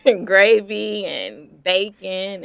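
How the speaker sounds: slow, loud, laughing through the list. Real, not acted. Remembering the Southern breakfast fondly, slow and laughing out loud. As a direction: savor each food with a real laugh.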